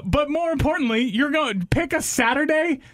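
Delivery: high-pitched indignant quoting; mock disbelief. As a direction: throw the offer back, incredulous. Indignantly quoting the booking back.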